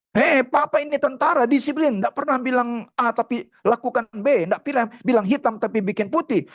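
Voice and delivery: high-pitched emphatic declaration; insistent father. Retold or imitated. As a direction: assert your word as a proud soldier dad. Soldier father asserts his word.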